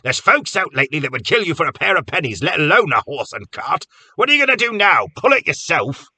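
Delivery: high, loud scolding rant; angry scolding. A narrator's reading. Cobb scolding Carter over the lost horse, angry. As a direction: wag a finger and let him have it.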